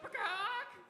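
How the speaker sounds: loud, high kenku squawk; bird squawk. Acted. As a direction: let out a piercing bird cry. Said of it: "he goes".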